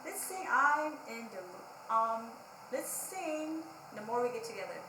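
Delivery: usual speaking voice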